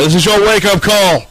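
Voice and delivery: a squad of guards booming a wake-up call; guards in unison. Acted. Guards at the door calling in unison. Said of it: "they all in unison go".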